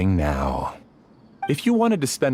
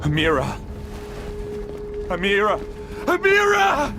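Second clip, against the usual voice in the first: desperate calling. Acted. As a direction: cry out her name in panic. Calling out to lost Amira.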